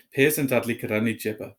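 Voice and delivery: plain reading voice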